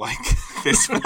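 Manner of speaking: words breaking up into laughter; laughing. Real, not acted. Critic panning a monster film, laughing in disbelief.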